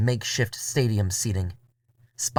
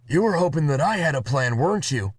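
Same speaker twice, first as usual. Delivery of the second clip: higher character voice; Murray's voice; 5 semitones higher than usual. Acted. Murray teases narrator about having no plan, higher voice. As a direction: lift into Murray.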